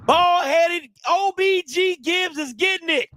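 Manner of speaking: high, loud trash talk; gloating, loud rallying cry; loud taunting. Real, not acted. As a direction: yell the threat at the rival team. Threatening rival team, hyped.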